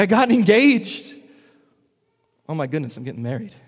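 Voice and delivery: thrilled bride-to-be; giddy newly engaged. Retold or imitated. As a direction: burst out with the happy news. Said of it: "It's like".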